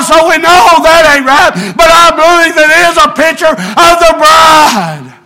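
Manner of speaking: preacher warming up into a holler again; rising sermon fervor. Real, not acted. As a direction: preach it out to the church.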